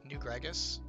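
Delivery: ordinary delivery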